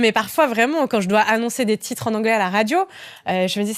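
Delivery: ordinary talk, nothing marked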